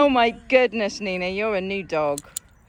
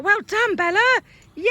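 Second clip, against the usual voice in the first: high, loud dog praise; excited praise; 9 semitones higher, 6 dB louder than usual. Real, not acted. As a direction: make a big fuss of the dog. Rewarding the dog with fuss.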